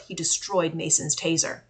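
plain reading voice

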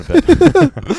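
a high, slow, hammed-up goodbye from a tired dad; squeaky mock exit. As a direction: jump way up high and slow for a silly exit line. Atticus quoted, joking voice.

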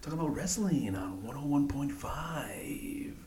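hushed, slow late-night radio DJ; radio station voice. Shown as a demonstration. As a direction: whisper it like a night radio host. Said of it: "Give me your radio station voice again".

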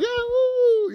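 very high whooping cheer; mock excitement. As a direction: whoop like a kid getting the best gift ever. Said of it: "We're so excited".

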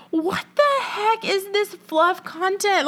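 high, drawn-out mock outrage; slow down and pitch it up in comic disbelief; incredulous outburst. Acting her outraged reaction to fluff.

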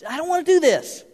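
high-pitched reluctant protest; mock contentious whine. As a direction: complain like someone balking at a chore. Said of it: "just contentious and I".

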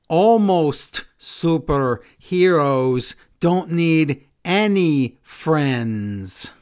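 slowly drawn-out villain line; dramatic slow line. Retold or imitated. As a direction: deliver it like a catchphrase. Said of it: "Nikki says this hot line".

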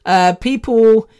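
usual speaking voice

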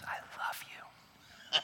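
slow soft whisper of love. Acted. Spouse whispering back at the wedding table, slow soft whisper. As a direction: breathe it tenderly, slowly.

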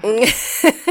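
mm over a sip of tea; while sipping a drink. Real, not acted. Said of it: "Let me sip on that".

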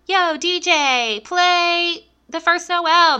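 high, slow laid-back "yo DJ"; casual caller. Pretend. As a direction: drawl it like a chill radio caller. Imagined casual caller request.

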